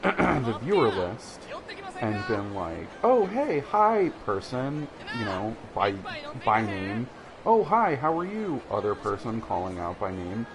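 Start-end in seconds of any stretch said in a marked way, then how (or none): 3.0-4.9 imitated hello, high and loud then easing; welcoming, friendly greeting. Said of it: "welcoming and friendly". 7.5-8.6 high, loud imitated hello; welcoming, friendly greeting. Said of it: "welcoming and friendly".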